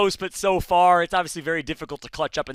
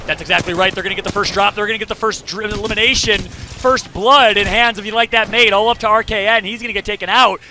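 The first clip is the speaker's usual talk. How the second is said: loud, raised, excited match call; live esports play-by-play; 6 semitones higher, 10 dB louder than usual. Real, not acted. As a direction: call the fight at full force.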